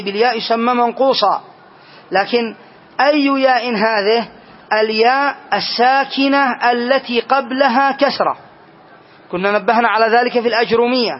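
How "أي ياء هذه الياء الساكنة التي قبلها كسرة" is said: slow rule. Shown as a demonstration. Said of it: "teacher stresses the rule".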